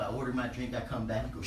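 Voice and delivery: ordinary talk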